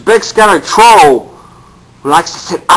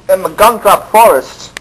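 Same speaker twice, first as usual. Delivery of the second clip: usual speaking voice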